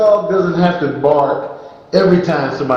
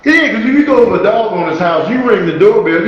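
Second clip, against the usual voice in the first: ordinary talk, nothing marked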